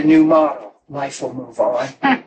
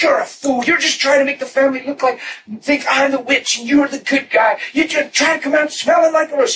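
wife's scornful retort; 8 semitones higher than usual. Retold or imitated. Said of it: "She's just self-defending".

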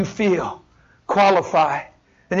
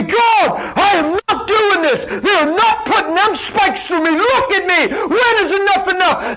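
exasperated outburst; 9 semitones higher than usual. Retold or imitated. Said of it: "drop the cross and say".

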